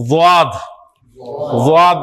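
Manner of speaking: वाद with the tongue edges pressed to the back teeth, neither ज़ nor द; teacher models वाद as a sound halfway between ज़ and द; Arabic dad sound. Shown as a demonstration. Quran teacher demonstrating the Arabic letter to students, careful pronunciation. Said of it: "ना जोए की आवाज़ आए ना दाल".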